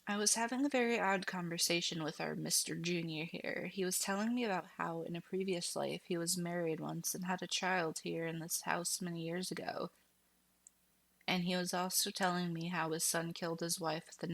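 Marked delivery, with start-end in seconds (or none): none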